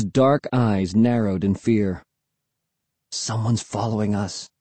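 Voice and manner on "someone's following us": fearful murmur. A narrator's reading. Boy warning his friend they are followed, murmured. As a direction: murmur it warily, under the breath. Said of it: "he murmured".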